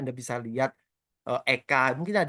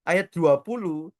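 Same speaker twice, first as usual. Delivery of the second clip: usual speaking voice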